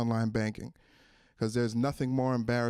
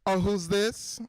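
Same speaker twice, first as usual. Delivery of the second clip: higher, mock-casual question; bitter ex-girlfriend; 6 semitones higher, slower than usual. Jilted ex introducing a new bank, bitter showing-off. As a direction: show off a new partner, feigning surprise. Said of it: "like a bitter ex-girlfriend".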